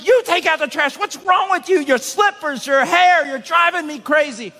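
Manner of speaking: high, loud hammed-up yell at the wife; mock angry outburst. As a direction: act out a husband blowing up at his wife. Husband snaps back in argument.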